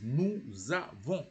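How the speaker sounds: NOUS AVONS linked with a z; liaison. Shown as a demonstration. Teacher shows learners the verb NOUS AVONS, liaison. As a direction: join NOUS to AVONS through a z. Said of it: "you have to join it".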